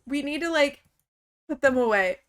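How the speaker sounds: ordinary delivery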